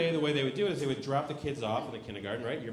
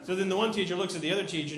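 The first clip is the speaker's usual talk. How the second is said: normal talking voice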